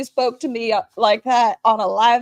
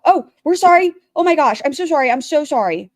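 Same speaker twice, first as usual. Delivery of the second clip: mocking apologetic parents; 7 semitones higher, 6 dB louder than usual. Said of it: "her parents quickly hands up".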